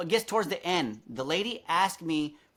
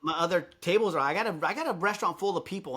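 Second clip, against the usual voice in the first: ordinary talk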